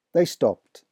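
ordinary delivery

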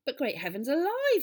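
high, loud, outraged exclamation; shouted outrage, pitch leaping up; furious shout. A narrator's reading. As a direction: explode in indignation. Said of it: "the little doctor shouted".

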